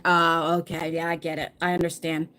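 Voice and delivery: usual speaking voice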